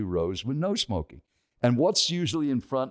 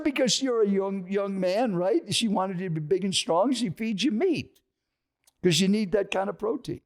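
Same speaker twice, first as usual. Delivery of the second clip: high-pitched, emphatic explaining; imitated preachy insistence, pitch held up; retold insistent lecture; 8 semitones higher than usual. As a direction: lecture them like a pushy stranger. Acted stranger lecturing him.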